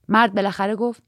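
usual speaking voice

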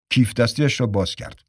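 plain reading voice

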